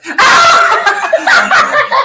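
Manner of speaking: sudden high loud laughing scream. Real, not acted. Friend blurting out a shriek on camera, loud and high. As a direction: burst out in a shriek. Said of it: "she just screams out and starts laughing".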